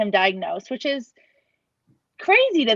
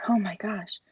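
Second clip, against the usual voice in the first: whispered amazement; hushed, unvoiced disbelief; awed whisper; 6 dB quieter than usual. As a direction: whisper as if the quiet might break. Amazed at sudden silence at home.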